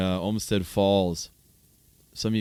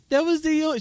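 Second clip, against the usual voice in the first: put-on much higher, childish whining voice; little girl voice. Quoting the little girl who lost her balloon, high childish whine. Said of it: "she says".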